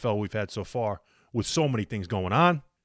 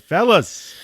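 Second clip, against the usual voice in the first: loud, high, take-charge fixer voice; calm the room like a cool fixer; Winston Wolf; 8 semitones higher, 9 dB louder than usual. Retold or imitated. Host quoting himself to friends, loud fixer voice. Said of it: "I channeled my inner Winston Wolf".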